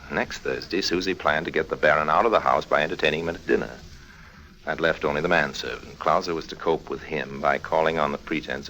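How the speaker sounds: low, even German-free narrator voice, telling it after the fact; story narration. A narrator's reading. Harry narrates the plan to the listener, in calm storytelling narration. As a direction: recount the plan like a radio storyteller.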